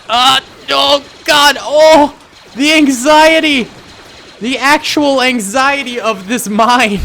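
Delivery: high, loud, drawn-out nervous wail; playful anxiety. Real, not acted. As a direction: agonize loudly and high over the suspense. Tense wait for mine to blow.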